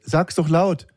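high mock encouragement; prodding parent. Parent tells whispering son to speak up, high prodding voice. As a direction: imitate a parent egging a child on.

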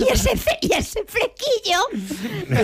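very high, drawn-out comic squeal; re-enacted slip in a high, incredulous voice; astonished blurt. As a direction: blurt it high, stumble, then stretch the last word. Re-enacts botched line on stage.

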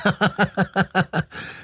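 high, odd, off-kilter chuckle; a laugh that gives the liar away; strange crooked laugh. A narrator's reading. Director covers his lie, odd crooked laugh. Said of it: "Er lacht seltsam schräg".

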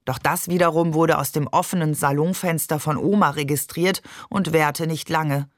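ordinary delivery

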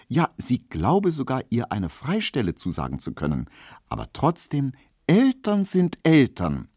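ordinary delivery

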